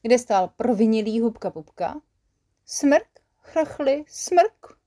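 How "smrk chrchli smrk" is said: much higher sniffles and wet coughs; sniffling and coughing. Acted. Guilty Hubka at the door, high sniffles and coughs. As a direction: sniff and hack like a miserable sick child. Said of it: "oznámil ve spolek".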